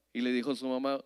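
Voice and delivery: ordinary delivery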